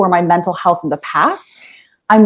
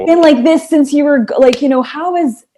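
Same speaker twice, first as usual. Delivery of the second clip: mock husband's complaint, pitched higher; exasperated husband; 7 semitones higher than usual. Wife retells her husband's complaint to friends, mock exasperation. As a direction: imitate a baffled husband pressing the point. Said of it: "my husband's like".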